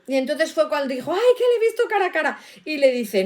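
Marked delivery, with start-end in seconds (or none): 1.1-2.4 high, very fast alarmed yelp; mock panic. Teacher voicing Gideon after seeing the angel, mock panic. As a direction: blurt it out in hammed-up alarm.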